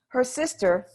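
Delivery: plain reading voice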